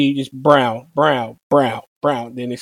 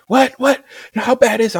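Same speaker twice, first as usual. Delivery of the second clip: aunt freaking out; 10 semitones higher than usual. Retold or imitated. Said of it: "she was freaking out".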